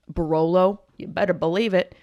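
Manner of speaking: normal talking voice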